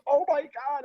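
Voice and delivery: high-pitched imitated astonishment; retold shock. Lady leaving the stall sees a man, retold shock. Acting out second lady's shock.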